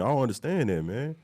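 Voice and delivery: ordinary talk, nothing marked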